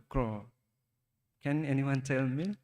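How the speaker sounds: ordinary talk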